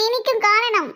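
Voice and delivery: ordinary talk, nothing marked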